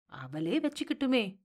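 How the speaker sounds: soft, drawn-out pleading from the wife; wife's quiet, drawling objection; hesitant drawl. A narrator's reading. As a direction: trail it out softly, a tentative suggestion. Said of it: "என்று இழுத்தாள்".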